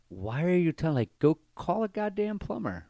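high-pitched, incredulous retort without extra volume; mock exasperation. Bartender dismissing a customer's plumbing problem, mock-exasperated. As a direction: send the voice way up, mock fed-up.

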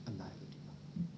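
soft unvoiced whisper; whispered scripture quote; whispered divine words. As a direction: breathe God's words out. Quoting God, fading to whisper.

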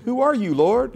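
high, gentle TV-host greeting; Mister Rogers. Shown as a demonstration. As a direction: greet him like a friendly neighbor. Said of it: "Like Mister Rogers greeting".

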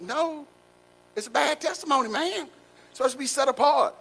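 high mock voice of a scolding coworker; imitating coworker's rebuke. Coworker rebukes his cussing.

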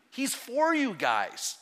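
slow, leaning hard on for; a drawn-out, earnest promise before the big swell; he's for you, said slowly and with weight; slow, emphatic assurance. Acted. Pastor building to a climax.

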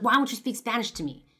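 loud, accusing outburst; bursting out angrily. Retold or imitated. Stranger accusing the young girl of hiding her Spanish, loud outburst. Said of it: "finally he bursts out".

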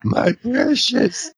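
high, slow, otherworldly my precious; Gollum-like voice. Retold or imitated. Doc clinging to the ring, mock Gollum croon. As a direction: croon it like a creature hoarding treasure. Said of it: "Doc was kept going".

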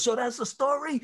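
hoog geroepen vraag naar het podium; high called question. Retold or imitated. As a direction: roep het hoog als een vraag. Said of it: "somebody goes".

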